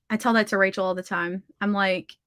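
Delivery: ordinary delivery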